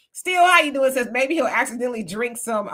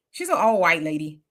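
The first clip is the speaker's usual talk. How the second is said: ordinary delivery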